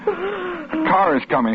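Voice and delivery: slow, high shaky cry; tearful relief. Acted. Husband cries out seeing rescue coming.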